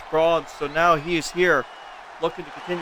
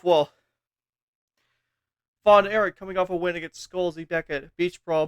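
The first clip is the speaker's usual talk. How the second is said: usual speaking voice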